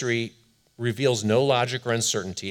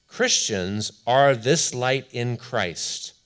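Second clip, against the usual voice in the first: reading slowly; much slower than usual. Shown as a demonstration. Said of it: "I'm going to read slowly a quote".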